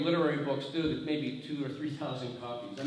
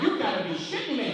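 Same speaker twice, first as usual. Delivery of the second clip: high-pitched comic astonishment; retold disbelief; 7 semitones higher, 4 dB louder than usual. Writer hearing Oprah picked his book, acted incredulous. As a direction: react in mock disbelief.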